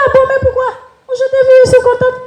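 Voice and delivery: very high and fast, delighted; excited protest. Retold or imitated. The daughter insisting she is happy to see her mother, very high. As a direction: rush it out gleefully.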